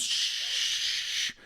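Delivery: hissed static noise. Acted. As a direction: make a radio static shh. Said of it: "she's literally making the noise".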